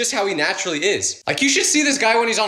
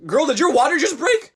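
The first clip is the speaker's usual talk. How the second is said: mock alarmed question; 8 semitones higher than usual. Students asking the pregnant teacher, alarmed and high. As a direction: blurt it out in alarmed worry. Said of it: "we would be terrified".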